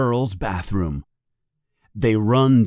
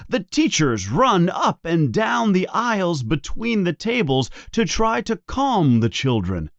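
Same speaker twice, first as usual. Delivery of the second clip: ordinary delivery, nothing marked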